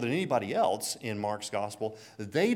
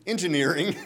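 proud answer, laughing; 5 semitones higher, 7 dB louder than usual. Retold or imitated. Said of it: "And he said".

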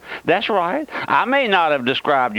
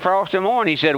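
ordinary talk

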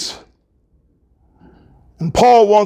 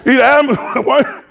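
ordinary talk, nothing marked

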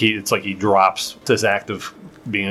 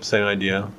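ordinary talk, nothing marked

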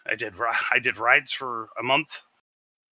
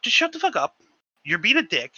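angry retort; 9 semitones higher, 4 dB louder than usual. Retold or imitated. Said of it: "So I'm like".

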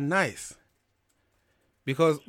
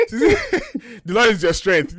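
slow, higher, lightly preachy line; mock preacher blessing; 7 semitones higher, 4 dB louder, much slower than usual. To a tired viewer starting a shift, mock preacher blessing.

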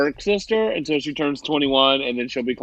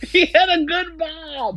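high, slow, giggling echo; laughing high mock voice; 3 dB louder, slower than usual. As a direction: squeal it out through laughter. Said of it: "I was like".

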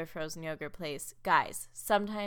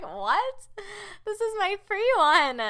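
high, loud mock outrage; shocked protest; 12 semitones higher, 8 dB louder, slower than usual. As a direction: yelp it in disbelief, pitched way up. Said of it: "I'm like".

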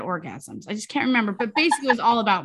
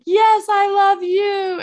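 excited reply; 9 semitones higher, 9 dB louder, slower than usual. Retold or imitated. Sister's reply retold.

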